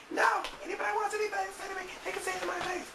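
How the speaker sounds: very high-pitched, put-on angry girl voice; mock outraged friend. Upset friend confronting whoever talks about her, high mock outrage. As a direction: squeal the challenge in mock outrage.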